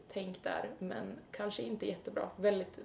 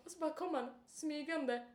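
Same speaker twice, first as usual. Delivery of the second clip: ordinary delivery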